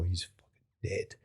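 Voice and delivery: quiet, slow menacing aside; a hushed slow threat; muttered threat. Retold or imitated.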